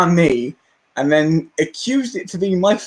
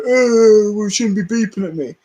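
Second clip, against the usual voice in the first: mocking whiny voice; 3 semitones higher, 3 dB louder than usual. Mimics careless pedestrians.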